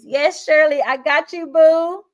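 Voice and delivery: cheerful call-out to a fan; excited shout-out. Real, not acted. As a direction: hype up a viewer by name. Answering viewer Shirley's chat comment.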